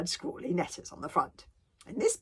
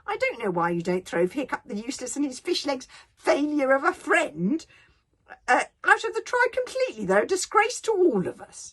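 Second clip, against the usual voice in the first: loud, high-pitched contempt; sneering; 7 semitones higher, 9 dB louder than usual. Acted. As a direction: sneer it out, rising to outrage. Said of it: "sneered Sn-, Sockface Snotlight".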